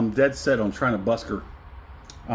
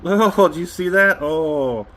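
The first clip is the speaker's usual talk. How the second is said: overwhelmed delight; 5 semitones higher, 5 dB louder than usual. Real, not acted. Overcome tasting the whiskey.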